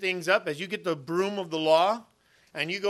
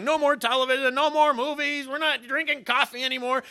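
high, emphatic laying down the law; strict new-convert dad; 8 semitones higher, 3 dB louder than usual. Retold or imitated. New convert announcing bans to his family, higher and emphatic. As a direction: ban it all in one breath, higher and firmer. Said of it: "he's telling his family".

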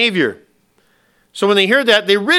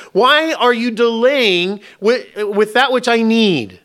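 higher, louder pleading complaint; whining prayer to God; 6 semitones higher, 5 dB louder, slower than usual. Retold or imitated. Believer whining at God about delayed prayers, pleading. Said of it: "Sometimes we ask God".

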